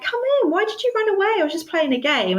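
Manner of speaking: high, quick sing-song coaxing; lightly mocking a sweet-talking abuser; mock innocent sweetness. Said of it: "Literally like Jekyll and Hyde".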